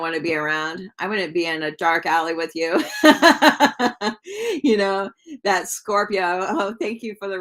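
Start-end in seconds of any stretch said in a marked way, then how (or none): 2.9-4.6 a burst of high, loud laughter; real laugh. Real, not acted. Reader cracks up at her own dark alley joke, laughing. Laughing during a live reading.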